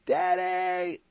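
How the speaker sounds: high, loud urgent call for Dad; imitated child's scream. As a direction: cry out like a kid in trouble. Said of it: "the second they screamed".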